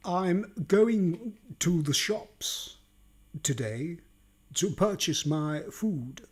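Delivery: slow, deliberate posh English; posh accent. Shown as a demonstration. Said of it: "Where does the posh accent come from".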